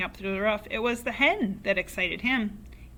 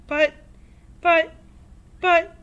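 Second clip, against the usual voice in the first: high, loud shocked stammer; stammering mother; 8 semitones higher, 9 dB louder than usual. A narrator's reading. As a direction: stumble over the words in alarm. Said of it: "stammered George's mother".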